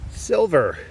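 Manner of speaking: sudden happy burst, high and loud; thrilled at the find; excited. Real, not acted. Finds a silver coin digging.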